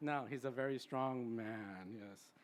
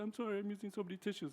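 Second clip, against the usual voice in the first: higher, quick, embarrassed "I'm sorry"; apologetic client; 8 semitones higher, 4 dB louder, faster than usual. Retold or imitated. A crying client apologizing to her therapist, in a high hurried voice. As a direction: rush the apology like a tearful client. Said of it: "they would apologize".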